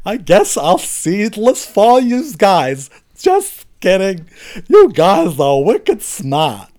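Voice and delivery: high, slow, hammed-up Boston accent; put-on Bostonese, pitched high and drawn out. Shown as a demonstration. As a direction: drag out the words in a broad, exaggerated Boston accent. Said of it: "here is my best Boston accent".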